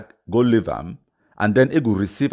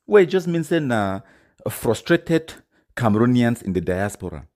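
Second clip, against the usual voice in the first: ordinary delivery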